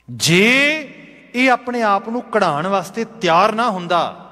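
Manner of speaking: high-pitched earnest explanation; acted impassioned confession; fervent realisation. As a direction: press the new insight with rising fervour. Craftsman argues the stone deserves credit.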